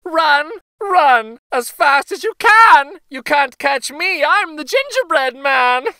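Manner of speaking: high, loud, gleeful taunt; sing-song jeer at his chasers; gingerbread boy taunting shout. A narrator's reading. Runaway cookie shouting back at old couple, high gleeful taunt. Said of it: "shouted back to them".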